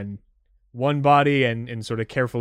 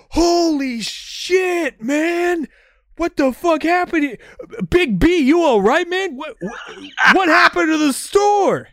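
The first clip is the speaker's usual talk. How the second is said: much higher, loud shouting surfer dude; stoner bros; 8 dB louder than usual. Acted. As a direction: panic loudly like a stoner bro. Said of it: "they're like".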